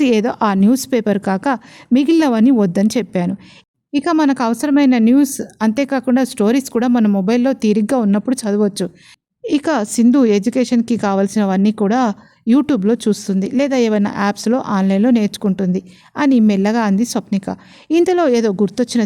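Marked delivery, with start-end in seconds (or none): none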